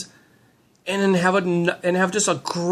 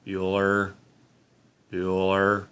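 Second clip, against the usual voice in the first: low, soft, deadpan droning name call; boring teacher roll call; 9 semitones lower, 5 dB quieter, slower than usual. Retold or imitated. Teacher taking roll to a silent class, low bored drone. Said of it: "let's go back to Ferris Bueller".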